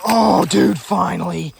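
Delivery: loud, thrilled shout; excited. Real, not acted. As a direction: cheer at the long-awaited catch. First pickerel caught after months.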